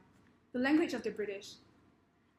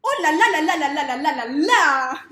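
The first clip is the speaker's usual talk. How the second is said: loud, rhythmic French exclamation; French accent; 4 semitones higher, 13 dB louder, faster than usual. Shown as a demonstration. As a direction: belt out a caricature French trill. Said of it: "how it would sound like in French".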